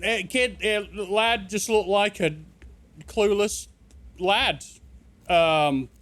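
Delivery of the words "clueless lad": ordinary talk, nothing marked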